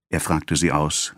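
plain reading voice

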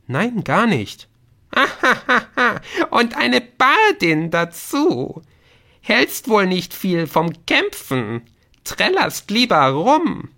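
very high, slow, jeering gnome; laugh, then taunt in a squeaky goblin-like voice; mocking gnome. (höhnischer Gnom, sehr hoch und gedehnt)

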